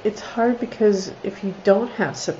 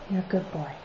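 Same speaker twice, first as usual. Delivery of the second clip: quieter fond murmur; soft praise to the dog; 8 dB quieter, much faster than usual. Real, not acted. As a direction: pet the dog with your voice.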